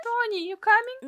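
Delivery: put-on high child voice calling out; a small girl calling for her brother; little sister calling. A narrator's reading.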